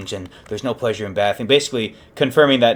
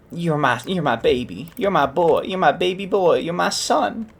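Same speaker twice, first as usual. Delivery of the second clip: high grandma voice, climbing with emotion; tender grandma; 8 semitones higher than usual. Acted. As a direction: reach out pleadingly as a doting grandmother. Grandmother reaches out to the killer.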